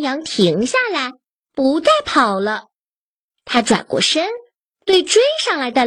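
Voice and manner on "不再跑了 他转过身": ordinary delivery, nothing marked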